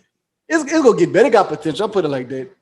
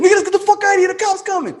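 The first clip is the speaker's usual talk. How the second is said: much higher, frantic urging to flee; panicked; 14 semitones higher, faster than usual. Retold or imitated. Friend after the shooting, panicked high plea to run. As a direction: squeal it like you just saw a body.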